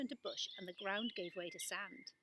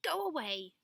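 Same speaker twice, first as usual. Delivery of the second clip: louder, higher angry shout; 5 semitones higher, 7 dB louder than usual. A narrator's reading. Grey city person to Alma and Tully, a shouted go away. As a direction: drive the strangers off. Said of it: "shouted one".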